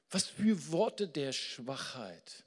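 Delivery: ordinary delivery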